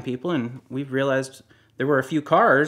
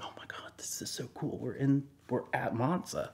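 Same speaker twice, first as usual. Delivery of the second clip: breathless thrilled whisper; excited whisper; 12 dB quieter than usual. Retold or imitated. To her husband inside Monza, in an excited whisper. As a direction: whisper it like you can't believe you got in.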